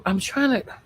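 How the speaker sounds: ordinary talk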